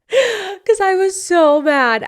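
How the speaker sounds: high, slow, drawn-out emphasis; emphatic admission. Real, not acted. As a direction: stretch it out high and loud. Laughing after acting out her outburst.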